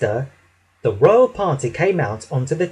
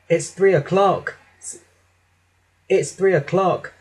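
ordinary delivery, nothing marked

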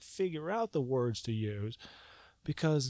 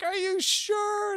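much higher, slow incredulous question; doubtful; 4 dB louder, much slower than usual. Retold or imitated. Questioning the vision, a high slow doubt. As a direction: stretch it out in disbelief. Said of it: "I'm like".

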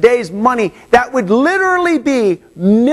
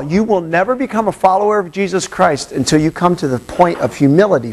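ordinary talk, nothing marked

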